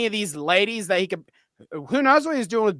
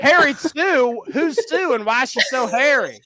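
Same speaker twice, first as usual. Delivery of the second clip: Harry Sue stretched slow and higher with a Southern drawl on Harry; drawn-out Southern accent; 5 semitones higher than usual. Retold or imitated. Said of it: "with a Southern accent".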